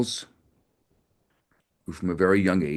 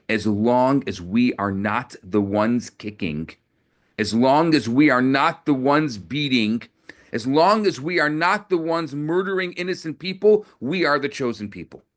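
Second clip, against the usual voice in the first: rising defiance; 4 semitones higher than usual. Acted. Rabbi's defiant climactic answer.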